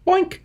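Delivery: squeaky cartoon boink; high boink sound effect. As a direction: pop the word up high.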